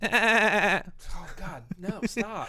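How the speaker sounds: mock horror. Real, not acted. Protesting the SpongeBob idea.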